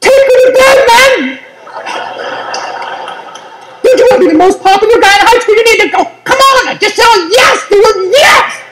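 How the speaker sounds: high, shouted egging-on voice of his urge; pushy inner voice. Acted. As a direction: cheer him on like a frat buddy, way up high. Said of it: "One side of me said".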